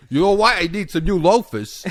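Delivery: loud, high mock New York voice; hammed-up New York shopper; New Yorker accent. New Yorker shopping online, loud put-on New York accent.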